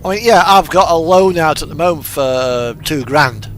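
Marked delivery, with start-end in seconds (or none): none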